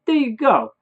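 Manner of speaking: high, loud, hammed-up neighbour; Bob. Neighbour hands over the candles, exaggerated high loud voice. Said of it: "what Bob would say in my head".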